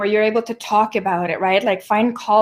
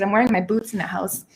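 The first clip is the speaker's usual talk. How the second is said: normal talking voice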